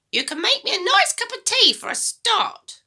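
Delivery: put-on high, loud, bossy old woman; a mean grandma giving orders, high and loud; grumpy grandma voice. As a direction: nag the boy like a sour old granny.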